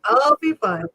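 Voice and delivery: high, loud put-on weepy voice; mock tearful. Retelling how she reassured her coach, mock tearful. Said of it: "tears are coming. I'm like".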